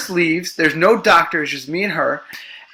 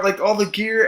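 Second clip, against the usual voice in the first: ordinary delivery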